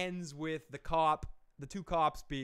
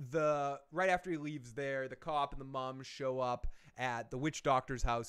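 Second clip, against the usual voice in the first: usual speaking voice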